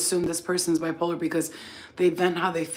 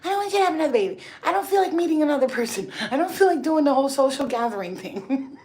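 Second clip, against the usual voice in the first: put-on high, fast pleading kid voice; daughter's whine; 8 semitones higher, faster than usual. Daughter begging mother not to marry, high fast whine. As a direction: rattle it off like a panicked kid.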